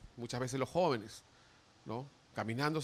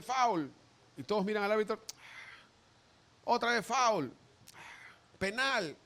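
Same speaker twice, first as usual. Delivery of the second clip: raised referee call; a referee calling fouls, raised; 5 semitones higher than usual. Acted.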